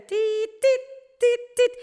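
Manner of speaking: high, loud electronic beeps; imitated monitor beeps. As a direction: beep like a heart monitor. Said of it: "y empieza tit". (pitidos agudos imitados)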